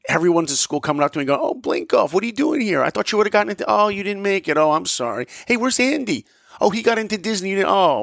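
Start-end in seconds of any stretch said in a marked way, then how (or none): 1.4-8.0 higher, mock-sympathetic classmates. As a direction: needle him with fake pity. Classmates voiced mocking him.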